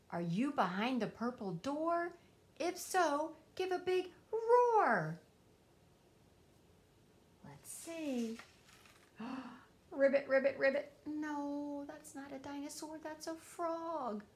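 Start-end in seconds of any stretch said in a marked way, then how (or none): 3.5-5.2 loud, slow and higher, a roar-like call; big drawn-out call. Acted. Asking the hidden dinosaur to roar. 9.9-10.9 loud playful ribbits, imitating a frog; frog croaks. Frog behind the door.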